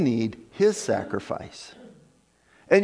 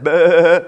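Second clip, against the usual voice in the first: loud, held sheep's "baa"; imitated sheep bleat; 9 dB louder than usual. Preacher mimicking the sheep behind Saul, loud bleating. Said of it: "you can just hear the".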